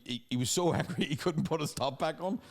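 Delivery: normal talking voice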